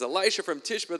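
the king exclaiming the name; excited king. Acted. King names the prophet.

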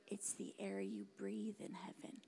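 soft, low near-whisper; hushed. Real, not acted. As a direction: let it drop into a reverent hush. Hushed awe about heaven.

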